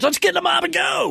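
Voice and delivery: hammed-up villager cry; mock rallying cry. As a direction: rouse the mob with torches.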